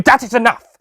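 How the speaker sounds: high, loud command; shouted. Acted. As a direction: shout him down. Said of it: "shouted the doctor".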